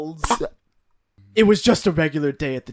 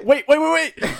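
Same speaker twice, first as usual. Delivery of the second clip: high, louder rapid pleading; frantic calling after; 10 semitones higher, 7 dB louder than usual. Retold or imitated. Reader mimicking guy following girl around, mock frantic calling. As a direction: blurt it repeatedly, acting out a desperate chase. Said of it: "and he's like".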